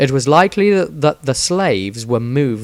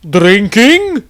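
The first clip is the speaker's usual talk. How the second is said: high, loud gruff foreigner's single word; a halting foreign warrior tries the local tongue; Tordrad voice; 9 semitones higher, 7 dB louder than usual. A narrator's reading. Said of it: "managed to speak Reichsbier enough to say".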